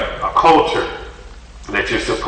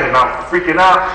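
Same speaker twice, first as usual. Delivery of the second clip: ordinary talk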